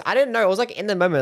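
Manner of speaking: ordinary delivery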